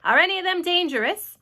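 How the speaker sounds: police chief calling loudly; shouted question. A narrator's reading. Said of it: "shouted the chief of police".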